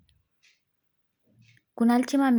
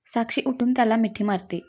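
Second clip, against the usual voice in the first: plain reading voice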